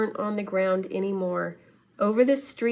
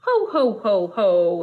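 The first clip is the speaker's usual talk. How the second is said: rumbling Santa laugh; 5 dB louder than usual. Acted. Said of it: "it rumbled faintly".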